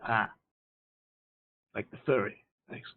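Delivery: ordinary talk, nothing marked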